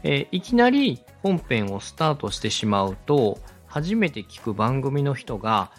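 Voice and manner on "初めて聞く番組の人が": ordinary talk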